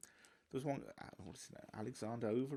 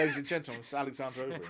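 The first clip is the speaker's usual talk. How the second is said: announcer-style booming intro, a touch louder; Alexander Overeem impression; 6 dB louder, faster than usual. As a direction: hype him up like a ring announcer doing Overeem. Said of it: "That was an unbelievably good impression".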